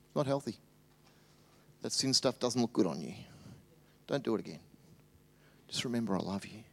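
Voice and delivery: soft, gentle voice of forgiveness; tender Jesus. Acted. Jesus speaking to the adulterous woman, soft and tender. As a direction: speak it softly, like comforting someone ashamed. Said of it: "He just goes".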